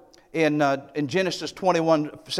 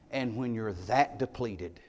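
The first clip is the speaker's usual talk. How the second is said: usual speaking voice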